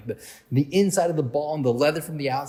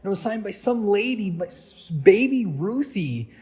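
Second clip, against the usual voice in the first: high-pitched imitation of a clueless kid; kid's naive rant, pitched up; 5 semitones higher than usual. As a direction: rant it out high, drawing out the name at the end. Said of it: "he goes on to this whole rant".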